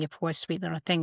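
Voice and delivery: ordinary talk, nothing marked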